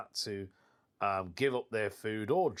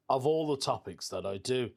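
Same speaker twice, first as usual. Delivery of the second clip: ordinary talk, nothing marked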